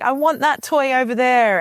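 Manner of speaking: high, whiny little demand; a touch of toddler whine. Retold or imitated. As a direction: voice a grabby toddler asking for a toy. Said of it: "And then, like".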